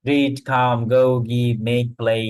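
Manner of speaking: usual speaking voice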